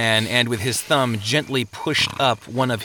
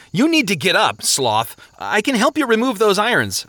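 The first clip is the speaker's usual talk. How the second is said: high, raised, insistent voice; loud urging; 9 semitones higher, 3 dB louder than usual. A narrator's reading. Traveler rousing a chained sleeper, loud and insistent. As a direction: press a sluggard to get moving. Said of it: "said loudly".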